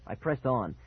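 plain reading voice

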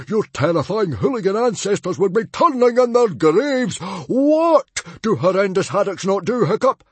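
high shouted lecture; fuming. Acted. Father quizzing his shamed son, fuming. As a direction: shout it, demanding an answer. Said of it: "fumed Stoic the Vast".

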